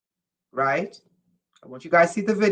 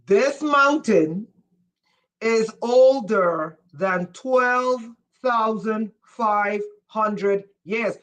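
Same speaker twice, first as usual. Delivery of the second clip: every word of the number spaced out slowly; slow emphatic repeat; slower than usual. Shown as a demonstration. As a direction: spell it out slowly like to a slow class. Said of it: "let me say it in French".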